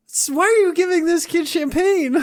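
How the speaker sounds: very high, loud squeaky disbelief; mock incredulous. Listener aghast at Barb giving a child champagne, mock shriek. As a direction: squeal the question in hammed-up shock.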